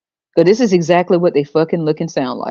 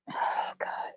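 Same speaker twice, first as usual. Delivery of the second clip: breathless whisper to self; whispered aside; 19 dB quieter than usual. Real, not acted. Comedian whispers in disbelief at the scene. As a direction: mutter it under your breath.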